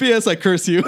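loud, laughing postscript curse; laughing mock curse. Friends inventing a spiteful letter, laughing. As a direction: hex them with a grin.

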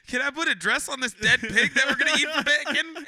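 high, loud mocking voice of his friend; mocking impression. Teasing friend about his failed proposal, mocking high voice. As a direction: ham up a clueless suitor. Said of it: "You were like".

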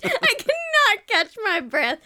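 wheezing through laughter; breathless laughing. Real, not acted. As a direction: gasp it out mid-laugh. Laughing so hard she can't breathe.

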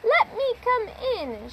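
high sly wolf calling. A narrator's reading. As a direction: coax the pigs like a sneaky wolf. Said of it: "said the wolf".

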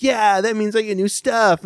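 high-pitched, eager kid imitation; mock excited kid. Kid hearing they get new stuff, mock excited. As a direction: cheer it like a thrilled child.

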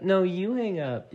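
playful couple teasing; mock lovey-dovey. Repeated louder, slower, higher.

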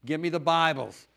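insistent order down the phone line; loud phone request. Retold or imitated. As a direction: call it in like you need them now. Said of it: "you get on the phone".

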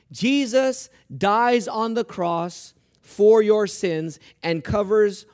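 slow, loud, weighty proclamation; forceful preaching. Real, not acted. Preacher telling the congregation of the cross, forceful and slow. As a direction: hammer each phrase home slowly.

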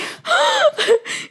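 sudden squealing high laugh. Real, not acted. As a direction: burst into a high delighted squeal. Remembering joy of Canada trip.

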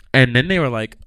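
normal talking voice

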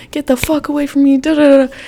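quick, high mock yell; retold screaming. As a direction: reenact yelling at him without real volume. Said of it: "screaming at him".

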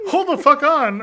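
amused outrage; laughing disbelief. Retold or imitated. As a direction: laugh it out in shock. Retelling shocked reaction.

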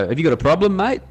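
looking to step outside; pub challenge. Acted. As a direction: pick a fight with a stranger. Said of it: "you're basically saying".

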